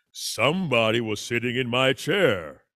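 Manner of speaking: deep, gruff Papa Bear voice; a big bear growling over his chair. Retold or imitated. Father reading Goldilocks, doing Papa Bear in a low voice. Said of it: "I love that voice".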